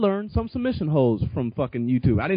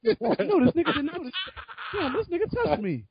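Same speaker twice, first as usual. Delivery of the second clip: high, laughing mock-gorilla voice, unimpressed; giggling, pitched-up impression of an unbothered gorilla; gorilla's reaction; 13 semitones higher than usual. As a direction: voice the gorilla shrugging off the punch through laughter. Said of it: "He'd just be looking like".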